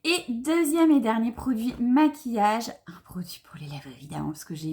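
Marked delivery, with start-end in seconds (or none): none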